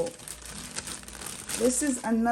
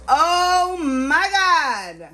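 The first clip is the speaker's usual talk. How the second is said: drawn-out loud exclamation, higher; loud slow oh my God; 6 semitones higher, 7 dB louder, much slower than usual. Real, not acted. Vlogger stunned by the gift, loud drawn-out exclaim. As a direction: let it ring out in disbelief. Overwhelmed by heart item.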